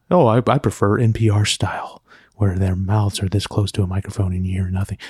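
breathy whisper of a fussy listener; pampered NPR fan. Retold or imitated. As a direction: whisper like a snobby radio purist. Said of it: "one of those pampered".

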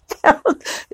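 a gleeful, high-pitched imitated cackle; imitated southern cackle. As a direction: mimic a friend's delighted southern cackle. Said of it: "her little southern cackle".

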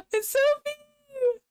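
very high, drawn-out gushing squeal; delighted squeal. Real, not acted. Host greeting the elf character's reveal, delighted high squeal. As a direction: squeal it out slowly, real delight.

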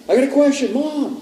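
high, loud imitated child; pestering kid. Boy pesters mother in the restroom, pestering kid. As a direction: pipe up insistently in a high kid voice.

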